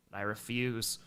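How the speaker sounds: understated, quiet refusal; low, quiet refusal. Real, not acted. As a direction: say it low and soft, almost to yourself. First take of repeated refusal.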